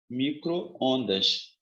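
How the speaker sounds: micro... ondas, pulled apart slowly; very drawn-out, measured delivery; slow dictation. Shown as a demonstration. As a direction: dictate the two halves with a gap. Dictates the compound word.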